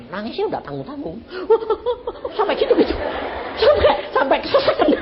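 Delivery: high giggling through the telling; laughing. Real, not acted. Preacher laughs telling congregation how she cried. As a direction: laugh while describing her sobbing.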